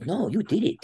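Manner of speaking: high-pitched insistence; imitated coach reassurance. Coach insisting wrestler really scored, high imitated reassurance.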